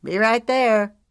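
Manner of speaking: loud, high yell back; yelled over shoulder. Acted. Mason answers his teammates with a loud yell. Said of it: "he yelled over his shoulder".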